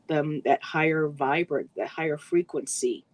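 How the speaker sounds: ordinary talk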